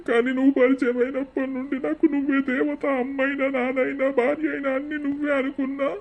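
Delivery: crying plea. Acted. Husband pleading with wife not to leave him, crying. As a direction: weep as you confess your love. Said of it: "ఏడవక ఆగు నువ్వు".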